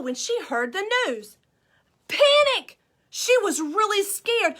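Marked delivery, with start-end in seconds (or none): 2.1-2.8 high loud cry of Panic; panicked cry. Acted. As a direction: burst out in alarm. Henny Penny panics.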